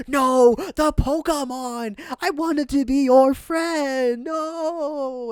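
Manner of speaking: high, slow mock-despairing cry; hammed-up grief, wailing no. Imitating Bill's sad cry as the Pokemon leaves. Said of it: "He's like".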